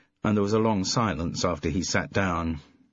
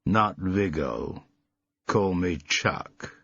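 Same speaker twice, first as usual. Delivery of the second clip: slow, deliberate American action-hero voice; slow rehearsed tough-guy delivery; movie tough guy; much slower than usual. A narrator's reading. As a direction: drop the line like a film star. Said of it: "gesture borrowed from some movie".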